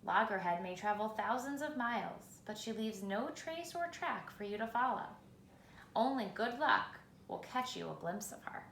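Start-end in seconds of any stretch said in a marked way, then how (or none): none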